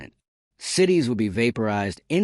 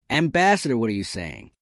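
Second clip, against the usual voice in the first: ordinary delivery, nothing marked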